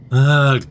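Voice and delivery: loud exasperated groan of disgust. Acted. Tange fed up with the lab rules, loud groan. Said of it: "Tange groans".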